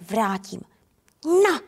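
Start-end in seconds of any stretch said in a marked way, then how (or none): 1.2-1.6 loud short spit of a cross kitten; spitting hiss. Acted. Kitten hits the little tomcat back, loud spitting hiss.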